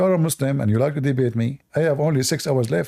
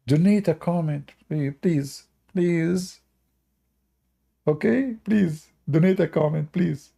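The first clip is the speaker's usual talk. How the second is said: playful begging; 4 semitones higher, slower than usual. Real, not acted. A streamer begging viewers for comments, playful pleading. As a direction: wheedle the viewers.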